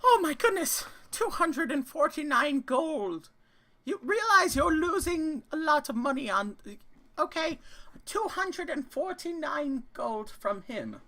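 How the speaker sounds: high squeaky gnome, shocked and loud; a gleeful little gnome shrieking at the bid; gnome merchant voice. Acted.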